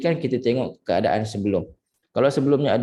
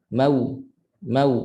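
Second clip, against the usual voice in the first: short "Mau", ma given less than a full beat; too quick. Shown as a demonstration. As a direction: rush "Mau" so the vowel falls short. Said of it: "bila laju tak cukup". ("Mau" laju, tak cukup harakat)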